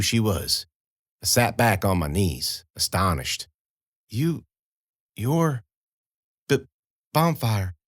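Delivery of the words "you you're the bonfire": put-on faint, broken, hesitant girl's words; lightly voiced frightened girl, pausing between words; halting weak girl's voice. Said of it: "the girl said".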